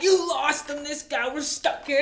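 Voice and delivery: high, loud mock scolding; mad engine boss. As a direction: imitate the boss yelling accusations up high. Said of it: "my engine boss is getting mad at me".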